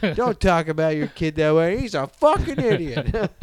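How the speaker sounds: hammed-up exasperation, climbing at the end; fed-up parents. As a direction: act out parents bickering over their kid. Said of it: "then you're out talking to your wife".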